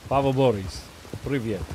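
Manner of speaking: put-on Russian accent. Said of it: "Russian accent a little more".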